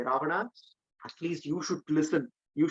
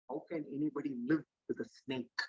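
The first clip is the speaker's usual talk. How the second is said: contempt; 8 dB quieter, faster than usual. A narrator's reading. Ravana speaks down to Vibhishana, with quiet contempt. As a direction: say it quietly, looking down on him. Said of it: "and with contempt, he looks down upon and says".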